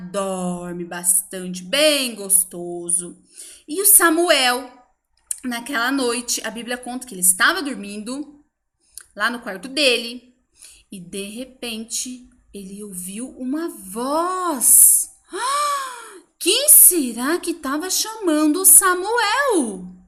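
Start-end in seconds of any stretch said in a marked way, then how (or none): 0.1-3.2 slow, drowsy, cozy voice; sleepy cozy. Acted. 15.3-16.2 sharp high gasp of surprise; surprised gasp. Acted. Mysterious voice surprises Samuel.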